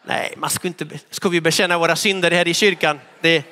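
ordinary talk

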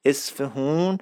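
"اصفهون" as es-fe-hoon, softly; soft, isolated "اصفهون" with h and long oo; outsiders' mispronunciation. Shown as a demonstration. Teacher dismissing a name outsiders invented, soft wrong "اصفهون".

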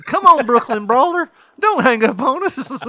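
loud, high mock begging; hammed-up pleading. Begging a caller not to hang up, desperate mock plea. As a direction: beg loudly, way up high.

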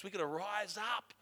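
normal talking voice

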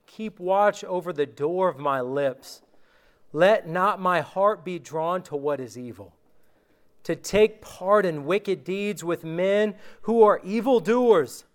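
normal talking voice

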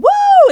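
a big loud cheering woo; high loud whoop. Retold or imitated. Cheering with the crowd, high loud whoop. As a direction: whoop like the crowd going wild.

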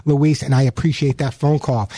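ordinary talk

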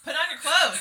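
loud high scream with a laugh. Retold or imitated. Ordering a stripped man to dress, loud laughing scream. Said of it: "I was screaming at him".

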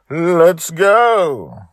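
big, high, loud call to start; excited shout. Real, not acted. Host kicking off the episode with a loud, high cheer. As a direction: launch the show with a shout.

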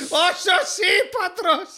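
high, drawn-out laughing disbelief; gleefully astonished, laughing through a slowed name; incredulous amazement. Real, not acted. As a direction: stretch ΣΩΣΙΠΑΤΡΟΣ slowly and high, cracking up. Repeats the absurd name in disbelief.

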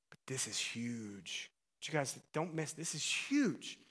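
slow, soft hushed whisper; whispered emphasis. Real, not acted. Preacher hushes the crowd on the key point, whispering slowly. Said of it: "whispered emphasis".

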